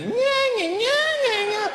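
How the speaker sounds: mock a nagging wife, shrill and loud; nagging woman mockery. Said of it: "listening to that".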